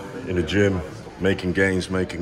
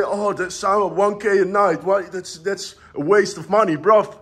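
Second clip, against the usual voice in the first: mock skeptic; 12 semitones higher, 4 dB louder than usual. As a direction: mimic a stingy doubter. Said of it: "You say".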